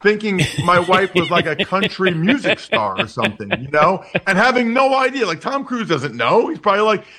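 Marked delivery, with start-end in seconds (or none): none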